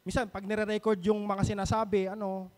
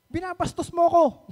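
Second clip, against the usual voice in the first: imitating her sudden loud, high outburst; a retold flare of temper, pitched up; sudden angry accusation; 9 semitones higher, 8 dB louder than usual. Housemate mimics the woman suddenly snapping at her, angry outburst. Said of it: "bigla magagalit".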